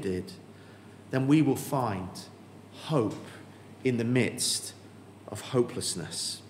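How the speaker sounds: hushed close. Real, not acted. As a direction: let it fade to a hushed hope. Sermon climax fading down.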